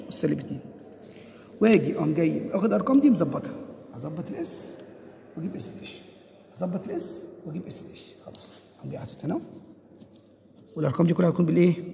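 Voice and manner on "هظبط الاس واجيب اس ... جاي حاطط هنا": talking to himself. Real, not acted. Teacher filling in a table while explaining, talking quietly to himself. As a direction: murmur the steps to yourself, barely voiced.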